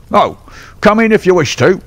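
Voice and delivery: bitten-back surrender; cornered bully grudgingly letting them in; grudging trainer. Acted.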